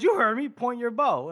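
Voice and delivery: high, firm acted command; insistent order. Corporal orders his recruit to aim, insistent. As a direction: repeat an order to a baffled subordinate.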